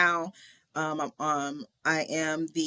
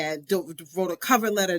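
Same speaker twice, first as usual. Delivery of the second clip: normal talking voice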